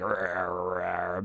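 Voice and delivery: low, soft growling like a dog. Retold or imitated. Kid growling at a classmate by the sharpener, low dog growl. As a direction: growl at him like a territorial dog. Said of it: "kid starts growling at him. Like a literal dog".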